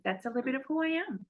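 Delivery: normal talking voice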